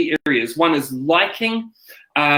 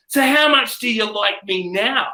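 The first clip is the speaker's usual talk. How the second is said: higher, mildly taunting question; taunting close-up; 5 semitones higher than usual. Retold or imitated. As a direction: lean in and needle them lightly. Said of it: "he gets really close and he's like".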